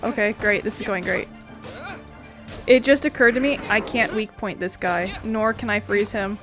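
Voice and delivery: ordinary talk, nothing marked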